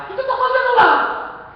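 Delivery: high, loud outburst; exasperated shout. Acted. Churchgoer fed up with the father's preaching, exasperated shout. Acted grumbling churchgoer questions being there.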